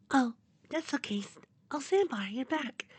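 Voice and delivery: tiny, high, soft voice; timid little high-pitched character voice; Fluttershy. Acted. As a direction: answer very softly, way up high. Fluttershy voiced answering Sandbar's apology.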